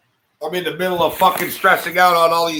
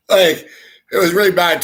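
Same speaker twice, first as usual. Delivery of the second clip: usual speaking voice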